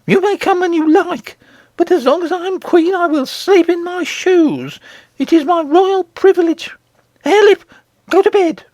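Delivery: very high, commanding queen voice; bossy queen, pitched far up; imperious goblin queen. A narrator's reading. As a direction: lay down the law in a high put-on voice.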